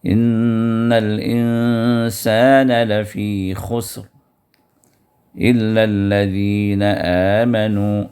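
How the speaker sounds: drawn-out, measured tajweed chant; slow Quran recitation. Shown as a demonstration. Teacher reciting Surah Al-Asr for students, slow chant. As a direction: recite each word slowly and melodically.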